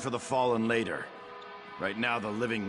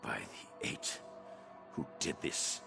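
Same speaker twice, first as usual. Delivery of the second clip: low, near-whispered shock; stunned hush; 10 semitones lower, 11 dB quieter, slower than usual. Acted. Survivor finds his people dead, stunned hush. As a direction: barely voice it, aghast at what you see.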